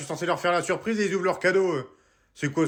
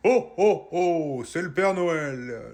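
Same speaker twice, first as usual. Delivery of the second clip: big ho ho ho; Santa voice; much slower than usual. Acted. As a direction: boom out like Santa. Santa announcing himself. (voix de Père Noël)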